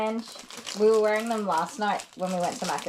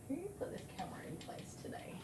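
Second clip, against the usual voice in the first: whisper; 7 semitones higher, 19 dB quieter than usual. Real, not acted. Whispering aside while grooming.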